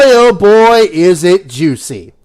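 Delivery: ordinary talk, nothing marked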